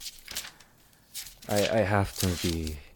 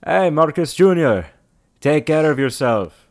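loud, high call into the distance; yelled farewell; 8 semitones higher, 11 dB louder than usual. Acted. Traveller to boy on departing caravan, yelling. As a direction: shout it after a departing wagon. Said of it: "I yell out to him".

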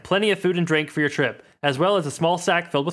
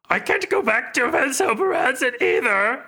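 high, slow put-on voice; Sereth voice; 8 semitones higher than usual. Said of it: "these voices are really taking a toll".

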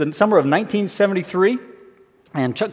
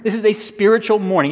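ordinary delivery

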